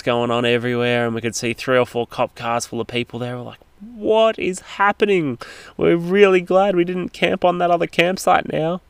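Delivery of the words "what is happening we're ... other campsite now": higher, slow incredulous voice; mock-amazed relief; astonished. Retold reaction to police raid.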